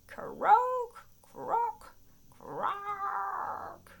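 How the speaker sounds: very high, loud croaking calls; loud frog croaks. Pretend. Showing children how loud frogs sing, high loud croaks. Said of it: "Some frogs sing very loudly".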